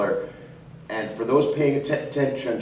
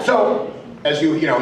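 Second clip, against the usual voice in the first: usual speaking voice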